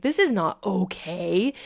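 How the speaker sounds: slow, stretched protest rising at the end; protesting emotional part. Acted. Emotional part answering your acceptance, slow protest. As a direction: draw it out in acted disbelief. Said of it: "the part of you that's emotional is like".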